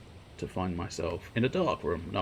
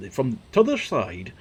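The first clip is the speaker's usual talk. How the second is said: slow, louder northern English t'other; northern English accent; 4 semitones higher, 6 dB louder, slower than usual. Acted. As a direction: drop into a Yorkshire-ish northern English accent. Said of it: "Don't know why he went northern".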